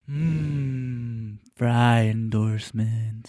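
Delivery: dreamy. Acted. Dreamy savoring.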